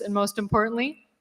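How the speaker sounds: usual speaking voice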